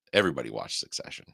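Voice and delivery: usual speaking voice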